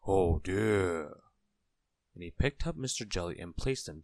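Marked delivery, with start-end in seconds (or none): 0.1-1.2 drawn-out, louder tramp voice; kindly tramp. A narrator's reading. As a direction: sigh the words out in kindly concern. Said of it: "he was a kindly tramp".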